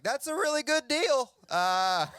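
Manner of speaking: high, loud, drawn-out mock amazement; amazed Mexican friends. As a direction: play friends astonished at the deal. Mexican friends react to deportation story.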